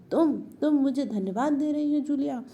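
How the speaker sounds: high, loud angry outburst; almost shouting. A narrator's reading. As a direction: burst out in disbelief, nearly yelling. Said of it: "ऊंचे स्वर में लगभग चिल्लाते हुए". (ऊंचे स्वर में लगभग चिल्लाते हुए)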